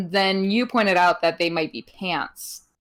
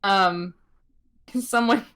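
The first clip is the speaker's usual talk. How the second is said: ordinary talk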